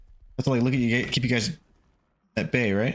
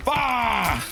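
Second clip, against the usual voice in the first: startled scream; 9 semitones higher than usual. Real, not acted. Jump scare.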